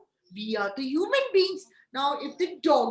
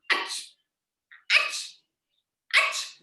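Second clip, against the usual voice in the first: short pretend cat sneezes; play-acted "achoo" sneezing; imitated sneeze; slower than usual. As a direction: sneeze in separate mock bursts. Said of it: "I'm sneezing".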